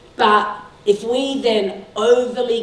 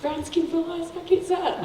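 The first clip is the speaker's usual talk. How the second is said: higher, coaxing charm on the teacher; mock charm; 8 semitones higher, 6 dB quieter than usual. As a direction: sweet-talk the teacher into bending the rule. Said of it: "have a little chat and say".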